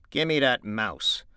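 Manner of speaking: brusque demand. Acted. George to Lenny about the mouse, slow brusque demand. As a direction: hold out your hand and insist. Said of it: "he said brusquely".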